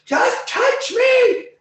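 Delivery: very high, slow, loud taunting call; shrill taunting call. Acted. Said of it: "And it's like".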